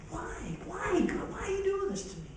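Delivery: pitched high, soft and pleading; anguished plea to God. Acted. Job pleading with God, asking why he suffers: high, soft anguish. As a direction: cry out to God like a broken man.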